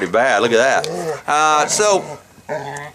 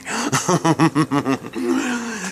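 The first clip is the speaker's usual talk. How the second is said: long rolling chuckle; 5 semitones higher than usual. Real, not acted. Storyteller after fudge-covered family joke, rolling chuckle. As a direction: chuckle at your own wisecrack.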